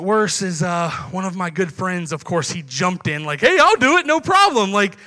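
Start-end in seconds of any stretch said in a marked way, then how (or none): 3.4-4.7 louder, higher eager friend voice; eager jump-in. Retold or imitated. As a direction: grab the chance before anyone else. Friend jumps at the offer.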